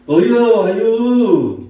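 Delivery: hammed-up Chungcheong impression; Chungcheong dialect. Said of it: "이렇게 말한대". (높고 크게 흉내 낸 충청도 사투리)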